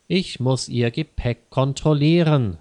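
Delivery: plain reading voice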